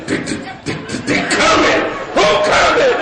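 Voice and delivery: stuttering, drawn-out attempt to get words out; hammed-up paranoid stutter; struggling to speak. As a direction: stammer on the first sounds, then burst out high. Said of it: "she couldn't say".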